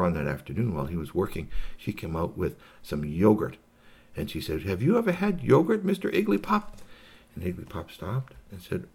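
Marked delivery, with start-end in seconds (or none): 4.6-6.6 high, sing-song storybook question; high-pitched hostess character; inviting hostess. Acted. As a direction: offer it in a high lilting voice. Hostess offers the alien yogurt.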